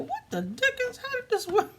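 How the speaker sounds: as if the pieces betrayed you; baffled surprise. Real, not acted. Crafter puzzling over pieces that won't fit, baffled high exclaim. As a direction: pitch jumps up high, puzzled and caught off guard.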